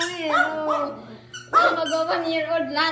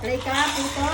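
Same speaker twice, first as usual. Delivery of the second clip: ordinary talk, nothing marked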